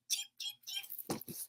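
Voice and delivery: tiny, very high, soft cheeps; chick cheeping. Acted. Storyteller voicing a chick, tiny high cheeps. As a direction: peep like a chick, slow and faint. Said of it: "A chick says".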